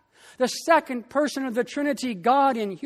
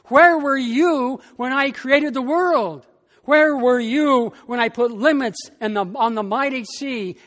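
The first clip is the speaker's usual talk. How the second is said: God thundering; 3 semitones higher, 6 dB louder than usual. Acted. God challenges Job in sermon.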